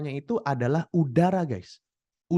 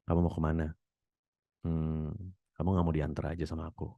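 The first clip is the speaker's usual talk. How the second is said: much lower, sultry bass voice; deep sexy voice; 10 semitones lower, 7 dB quieter, faster than usual. Shown as a demonstration. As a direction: purr it way down low like a flirt.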